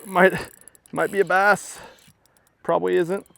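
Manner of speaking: hardly daring to hope it's a bass; hopeful excitement. Real, not acted. Hooked fish, hopeful it's a bass.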